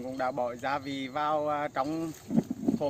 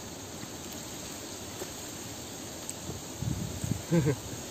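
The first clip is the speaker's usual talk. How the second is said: quiet breathy laugh; 10 dB quieter than usual. Real, not acted. Long laugh while eating.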